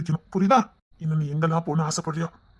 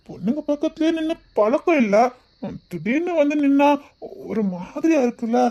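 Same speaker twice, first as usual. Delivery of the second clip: higher pitched, flustered nerves; nervous; 7 semitones higher than usual. Acted. Shy man admitting to the girl he feels nervous. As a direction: confess it with a nervous laugh. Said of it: "கொஞ்சம் பயம்".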